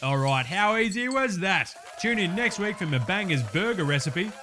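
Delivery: cocky Aussie bloke hosting his own cooking show; ocker cooking host. Acted. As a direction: play a larrikin TV chef.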